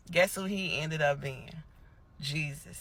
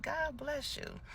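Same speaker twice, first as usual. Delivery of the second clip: higher, much softer frail thank-you; old man thanking; 10 semitones higher, 8 dB quieter, slower than usual. Retold or imitated. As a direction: lightly voice a grateful elderly man. Voicing the old gentleman.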